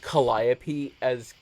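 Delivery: Calliope as kuh-LIE-uh-pee; Calliope, second syllable said like "lie". Shown as a demonstration.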